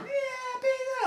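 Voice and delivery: very high squealing cheer; imitated girls' cheer. Limo girls cheering for pizza, high squealing cheer. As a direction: squeal it like an excited girl.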